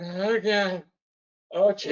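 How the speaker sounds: panicked inner voice. Retold or imitated. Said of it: "my heart just went".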